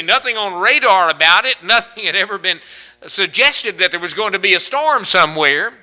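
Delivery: loud, high, driving sermon voice; full-force preaching. Acted. Preacher thundering to the congregation, loud and high. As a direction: preach it out to the crowd.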